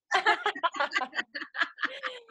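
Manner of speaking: a real high-pitched burst of laughter. Real, not acted. Se ríe del acento de Mérida. (risa aguda y espontánea)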